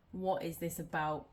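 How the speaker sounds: normal talking voice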